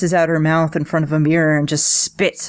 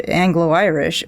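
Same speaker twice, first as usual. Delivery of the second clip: normal talking voice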